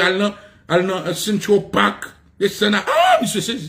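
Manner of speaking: ordinary delivery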